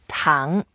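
糖 gliding upward in pitch; rising tone. Shown as a demonstration. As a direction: let 糖 climb like a question. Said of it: "We go up".

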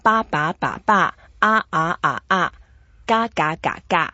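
quick "ba", "ah", "ga", each level, rising, dipping, falling; four tones, other syllables. Shown as a demonstration. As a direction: run "ba", "ah" and "ga" through the tone pattern. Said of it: "find a few other sounds".

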